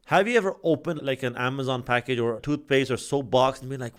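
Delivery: ordinary talk, nothing marked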